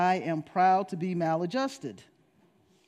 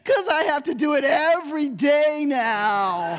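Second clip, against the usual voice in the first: put-on loud, blubbering kid voice; mock crying child; 5 semitones higher, 6 dB louder than usual. Son sobbing the punchline to his mother. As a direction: sob it out like a small boy.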